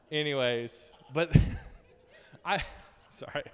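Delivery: usual speaking voice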